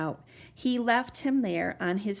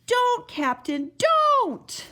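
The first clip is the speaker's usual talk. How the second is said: building to a high, loud cry; desperate shout; 14 semitones higher, 6 dB louder, much slower than usual. A narrator's reading. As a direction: scream to stop him. Stopping captain eating oobleck.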